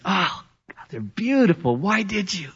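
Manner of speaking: a lightly voiced wife, surprised by roses; wife's delighted surprise. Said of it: "she looks and says".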